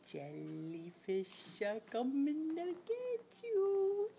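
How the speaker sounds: slow, stretched, steadily climbing pitch; playful menace. Pretend. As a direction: creep up on a toddler before tickling. Teasing tickle game with toddler.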